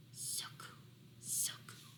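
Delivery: whispered echo of so cool; airy whispered so cool. Shown as a demonstration. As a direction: breathe so cool softly, no voice.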